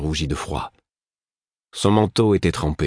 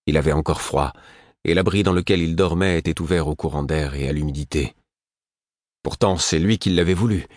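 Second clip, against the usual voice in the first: ordinary delivery, nothing marked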